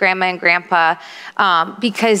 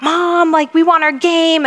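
imitated kids' whine, higher pitched; acting-up kids; 8 semitones higher than usual. Kids nag mom for their game, mock complaining. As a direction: mimic children pleading up high with mom. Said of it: "they were acting up".

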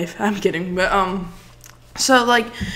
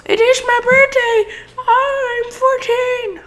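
mock kid voice; slower than usual. Joking about his age.

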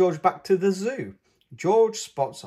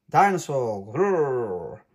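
playful growl; mock dinosaur roar; 4 dB louder, slower than usual. A narrator's reading. Child spotting a dinosaur, playful growl. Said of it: "roars George".

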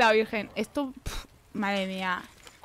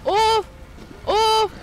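high, loud panicked yelp and curse; panicked shout; 8 semitones higher, 4 dB louder than usual. Real, not acted. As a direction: blurt it out in a real panic. Fleeing zombies, cursing them.

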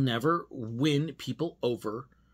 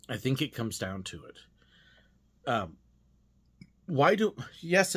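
ordinary talk, nothing marked

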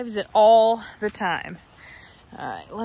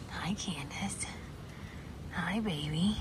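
whispered greeting; 4 semitones lower, 13 dB quieter, slower than usual. Real, not acted. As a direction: whisper hello so you don't startle her. Greeting goats softly up close.

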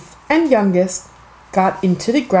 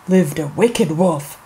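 ordinary delivery

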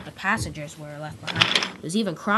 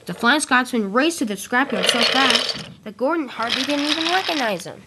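plain reading voice

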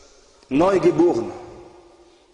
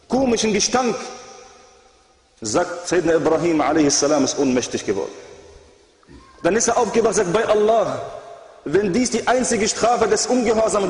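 higher, intense sermon voice; fiery preaching; 7 semitones higher than usual. Acted. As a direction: preach it with rising urgency. Impassioned sermon climax.

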